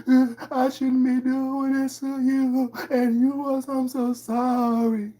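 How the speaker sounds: high, slow, hammed-up tearful rambling; mock crying ramble. Mocking the tearful apology video, high and slow. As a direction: blubber it out as a parody. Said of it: "apologize in tears".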